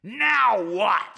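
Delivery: put-on fed up old man; high codger groan; exasperated Charlie. Charlie annoyed reply.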